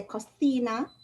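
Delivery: "cocina" as "ko-THEE-na"; Spanish th for C. Shown as a demonstration. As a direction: lisp the second c of "cocina" as th, as in Spain. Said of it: "an S with a th".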